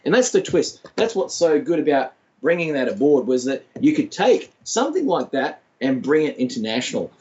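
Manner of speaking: usual speaking voice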